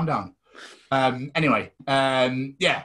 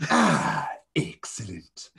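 Mister Burns; 3 semitones lower, much slower than usual. Acted. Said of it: "I like doing Mister Burns".

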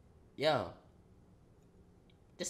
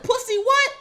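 very high, loud taunting yell; shouted taunt; 13 dB louder than usual. Retold or imitated. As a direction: scream the challenge at him. Retold fight taunt.

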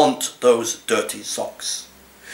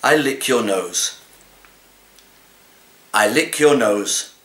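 ordinary talk

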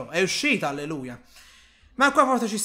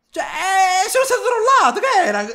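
agitated; 8 semitones higher, 5 dB louder than usual. Real, not acted. Reacting to scare.

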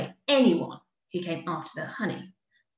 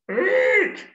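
high, loud frightened squeal; scared bear squealing in terror; 13 semitones higher, 7 dB louder than usual. Acted. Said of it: "squealed the bear".

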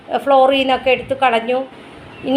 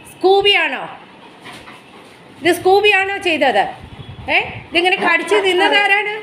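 mock scolding; 5 semitones higher than usual. Real, not acted. Scolding dog, slow questions.

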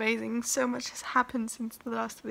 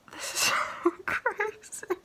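teary; 9 semitones higher than usual. Real, not acted. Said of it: "I'm crying".

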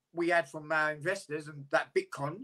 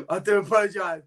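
laughing. Real, not acted. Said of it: "I'm laughing right now".

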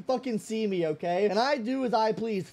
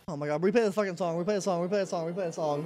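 low, fast repeated request; rapid urgent muttering; 7 semitones lower, 5 dB quieter, much faster than usual. Real, not acted. Asking for the song to be replayed, low and urgent.

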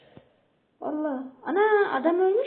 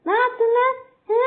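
higher, louder indignant cry; outraged shout; 7 semitones higher, 7 dB louder than usual. Real, not acted. Gamer yelling at an opponent, outraged and loud. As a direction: bark it in disbelief. Reacting to enemy's move.

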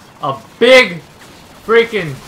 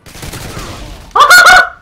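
high laugh; 5 dB louder than usual. Real, not acted. His claymore fails.

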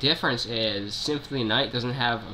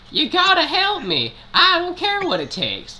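very high, loud squeaky twang; 7 dB louder than usual. Acted. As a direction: squeak it out like a hillbilly mushroom. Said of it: "that redneck voice sounds really horrible".

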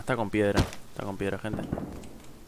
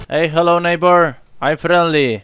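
higher, louder hail, slow at the end; calling out; 5 semitones higher, 6 dB louder, slower than usual. Acted. Hailing another player over voice chat, higher and louder. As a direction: call out to a stranger and confront him.

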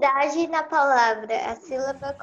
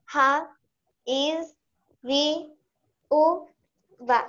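syllable by syllable; much slower than usual. Shown as a demonstration. Student reading out hiatus examples for the class, syllable by syllable. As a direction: split into separate syllables with clear pauses.

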